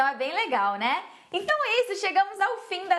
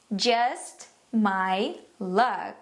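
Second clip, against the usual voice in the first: very slow, lower, long pauses; word-by-word model; 5 semitones lower, much slower than usual. Shown as a demonstration. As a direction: separate "Just", "my" and "luck" for learners. Phrase broken down for learners. (palavra por palavra, bem devagar)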